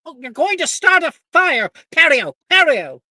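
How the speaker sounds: high, loud panicked shout; panicked yell. Acted. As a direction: shriek the spell in panic. Panicked NPC casting spell.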